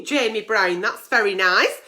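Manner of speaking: usual speaking voice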